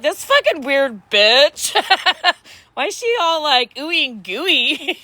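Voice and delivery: high, slow, mock puzzled inner voice; imagine her side-eyeing you; mock bemused friend. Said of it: "She's probably like".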